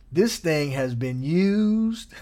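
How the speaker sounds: ordinary talk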